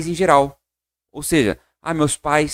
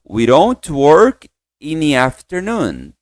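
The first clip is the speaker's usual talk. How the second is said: slow, careful English read; slow model sentence; 4 dB louder, much slower than usual. Shown as a demonstration. Teacher repeats the full sentence slowly. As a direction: stretch each word for the class. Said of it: "Então, no".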